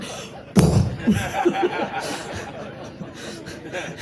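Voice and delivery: a long, airless laugh; breathy laugh. Real, not acted. Laughing at the image of Eleanor exploding, a breathy laugh. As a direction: laugh silently, only breath.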